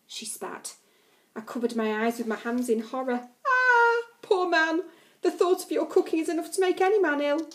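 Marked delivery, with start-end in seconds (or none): 3.4-7.5 high, loud sarcastic sympathy; a jeering, high mock lament; mock pity. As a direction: ham up the fake pity. Narrator mocks January's cooking.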